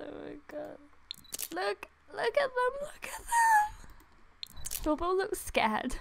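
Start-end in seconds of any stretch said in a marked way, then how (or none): 1.5-4.8 high, slow, breathy squeal of delight; excited squeal. Real, not acted. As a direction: squeal it out over something adorable. Squealing at cute cats.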